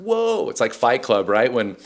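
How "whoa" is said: high, sudden mock surprise; high-pitched startled "Whoa". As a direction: catch yourself mid-reaction. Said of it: "I'm like".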